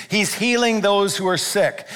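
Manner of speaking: ordinary talk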